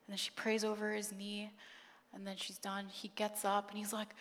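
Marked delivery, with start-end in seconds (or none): none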